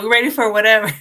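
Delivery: normal talking voice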